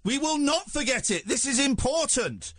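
high, insistent protest; insistent refusal. Real, not acted. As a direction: overrule them, emphatic and high-pitched. Host overrules the guest who wants to skip.

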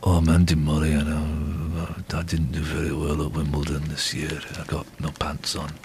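low, soft, downbeat monotone; deep, dreary tennis player impression; Andy Murray. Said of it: "Do you wanna do your Andy Murray?".

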